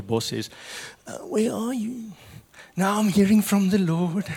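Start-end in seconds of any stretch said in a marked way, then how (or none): 1.1-2.1 higher, slow put-on boss voice, puzzled; annoyed boss. Boss calls late employee. 2.8-4.3 put-on higher, louder voice of a lightly voiced devout worker; pious worker excuse. Worker excuses himself to boss.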